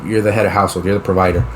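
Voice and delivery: ordinary delivery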